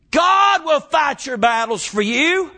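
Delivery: shouted, high preaching cadence; preaching at full force. Acted. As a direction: lift it into a full-throated sermon shout.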